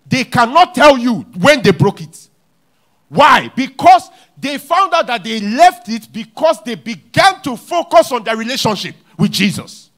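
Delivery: loud, high preaching climax; full-force sermon shout. Acted. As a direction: preach it out to the crowd.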